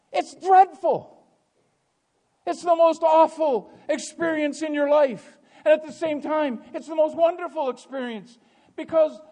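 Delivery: loud, high, fervent pulpit voice; full-force preaching. Acted. Preacher asking congregation about being lost, full-force. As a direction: hammer each phrase to the congregation.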